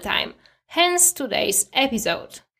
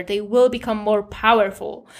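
usual speaking voice

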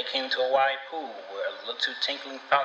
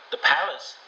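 higher, puzzled repeat; surprised question; 6 semitones higher than usual. A narrator's reading. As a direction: echo it back, surprised. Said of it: "the girl repeated".

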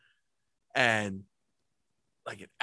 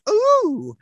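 very high, loud "ooh" squeal; giddy high-pitched coo; retold excitement; 5 dB louder than usual. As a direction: squeal it like a thrilled kid spotting a treat. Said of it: "I was so excited".

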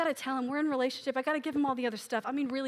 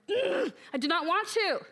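higher-pitched petulant balk; groaned, exaggerated refusal; stubborn refusal; 6 semitones higher than usual. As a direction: dig in like a reluctant kid. Acts out refusing God's talk.

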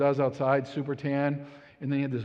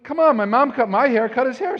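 higher, louder coaxing; insist, come on now; mock exasperated husband; pleading argument; 9 semitones higher, 9 dB louder than usual. Arguing with wife.

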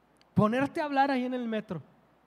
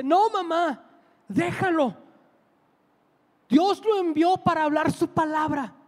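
voz aguda de hijo que interviene; súplica enérgica imitada; 6 semitones higher, 3 dB louder than usual. Retold or imitated. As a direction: imita a un hijo frenando a su madre, con urgencia. Hijo defiende al predicador ante madre. (ruego urgente y agudo, actuado)

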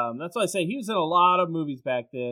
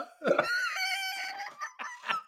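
high-pitched real laughing fit; helpless high laughter at a silly impression; 7 dB quieter than usual. Real, not acted. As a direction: crack up in a long high laugh.

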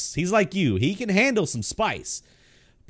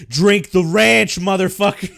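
mock yell; 4 semitones higher, 9 dB louder than usual. Friend yelling at buddy to drink the ranch, loud mock yell. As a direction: bark it at him like a rowdy friend. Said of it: "she was like".

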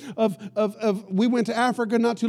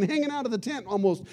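usual speaking voice

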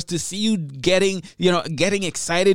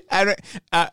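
ordinary talk

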